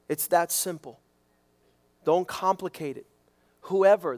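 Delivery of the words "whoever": Whoever punched louder; stress on WHOEVER. Shown as a demonstration. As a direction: lean hard on Whoever. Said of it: "that word includes everyone".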